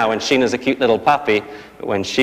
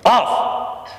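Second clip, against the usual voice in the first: sudden high barked "Off!"; sharp command; 10 semitones higher than usual. Shown as a demonstration. Trainer snapping a command at the puppy. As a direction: snap the command at the dog. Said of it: "So we say".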